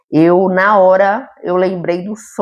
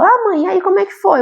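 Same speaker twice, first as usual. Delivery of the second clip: put-on higher, eager little girl; child's eager voice; 13 semitones higher than usual. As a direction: lightly voice the daughter asking. Daughter asks about ultrasound.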